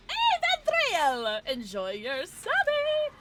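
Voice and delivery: high loud greeting, stretched slowly; excited shout-out. Real, not acted. Streamer congratulating Vandreal on the sub, stretched cheer. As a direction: call it out bright and drawn out. Thanking sub gift recipient.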